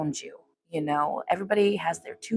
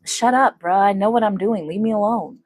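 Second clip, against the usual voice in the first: annoyed retort; 3 semitones higher, 9 dB louder than usual. Retold or imitated.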